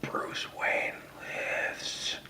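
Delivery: hushed, breathy spoiler whisper; secretive whisper. Pretend. Podcaster whispering the ending to listeners as a secret, in a whisper. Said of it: "big spoiler".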